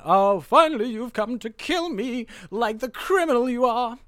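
hammed-up dramatic dare to an enemy; Javert. Javert confronting the man come to kill him, theatrical. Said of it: "Javert is like".

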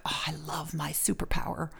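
hushed secret whisper. Pretend. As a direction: breathe it like a confession. Imagined superhero talking to herself.

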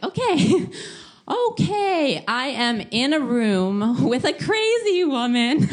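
high, loud, slow rising panic; mock panic. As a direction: stretch the panic over every word. Panicking about crazy roommate.

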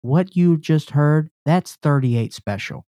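mock concert announcer intro. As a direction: introduce the band like an emcee. Hosts a concert-style introduction.

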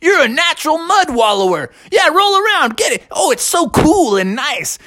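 high, chummy pig voice. Acted. Pig coaxing the bear into the mud, high and chummy. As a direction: play the pig urging the bear in.

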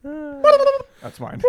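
high, laughing squeal. Real, not acted. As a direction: crack up, voice going high. Cracking up at the joke.

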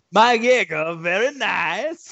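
high, loud mock Borat accent, slow on very nice; Borat impression. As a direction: ham up a Borat catchphrase. Mimicking Borat after Borat remark.

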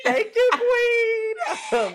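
very high, loud and very slow; shouted cheer. Real, not acted. Cohost echoing the toast to the Queen, shouted high. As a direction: belt it out to the heavens.

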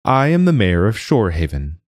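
a touch pompous, official and formal; lightly voiced mayor greeting the new wizard, in a mayoral tone. A narrator's reading. Mayor announcing himself to the wizard, formal and official. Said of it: "in his most mayoral tone".

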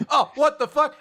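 high, loud mock outrage; shocked. Villain startled by Gelda showing up, mock shock. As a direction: blurt it in exaggerated alarm.

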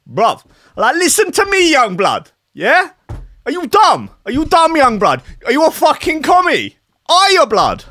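high-pitched mock street-slang patois. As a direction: confront him in exaggerated urban patois. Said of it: "slight patois to it".

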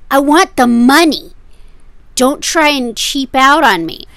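loud, high, blunt seller; pushy seller loudly demanding cash. Retold or imitated. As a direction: spell it out bluntly to cheapskates. Seller states his real demand.

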